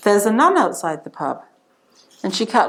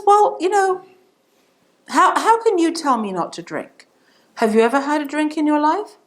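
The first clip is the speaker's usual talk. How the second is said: higher-pitched, indignant comeback; annoyed challenge; 7 semitones higher than usual. Acted. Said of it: "he starts to get annoyed with this nun".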